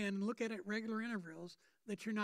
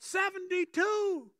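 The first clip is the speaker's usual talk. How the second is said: loud, high, stretched-out holler; prisoner yelling out; 9 semitones higher, 11 dB louder, slower than usual. Acted. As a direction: yell it slowly across the prison ward. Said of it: "yells out".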